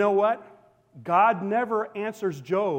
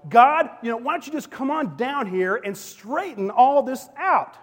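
frustrated plea; 6 semitones higher, 4 dB louder than usual. Retold or imitated. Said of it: "Job gets so frustrated, he says".

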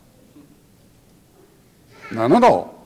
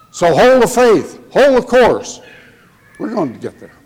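urging; 4 semitones higher, 3 dB louder than usual. Real, not acted. Preacher exhorting the congregation.

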